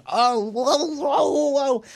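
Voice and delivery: high, wobbling slapstick whoa; drawn-out high comic wail; mock reeling wail. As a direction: imitate a clown knocked off balance. Imitates man hit in chest.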